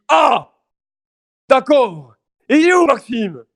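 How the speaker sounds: put-on high, loud drunken surprise; mock drunk neighbour realising. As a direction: exclaim like a drunk.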